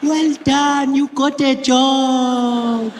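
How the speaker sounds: high, drawn-out granny voice; imitated granny, high and slow with long vowels; excited grandmother. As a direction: congratulate like a thrilled old grandmother. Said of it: "she was really excited".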